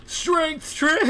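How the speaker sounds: shouting way up high and loud; sudden yell. Real, not acted. Gamer yelling at his teammate, loud high shout. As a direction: bellow it out at the screen.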